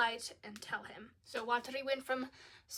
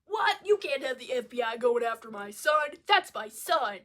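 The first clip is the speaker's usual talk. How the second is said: angry shout; 8 dB louder than usual. Retold or imitated. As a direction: yell it like a frantic father. Dad shouting at the FBI.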